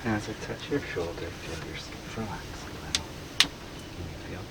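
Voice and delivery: hypnotic induction. Real, not acted. Hypnotist telling subject to relax, soft hypnotic voice. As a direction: lull them gently into relaxing.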